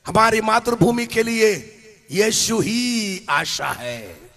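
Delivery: slow, ringing declaration from the pulpit; preaching proclamation. Acted. Preacher declaring to the congregation, slow and ringing. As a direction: proclaim it to the whole hall.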